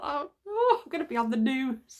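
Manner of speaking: high, loud mock panic; comic panic. Imagining herself on the news, a high and loud mock panic. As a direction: ham up the dread of the TV cameras.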